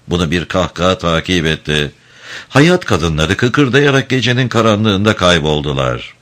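ordinary delivery, nothing marked

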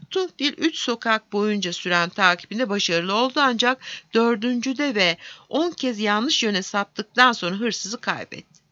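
ordinary delivery, nothing marked

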